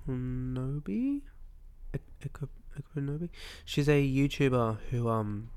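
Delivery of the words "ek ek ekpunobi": ordinary talk, nothing marked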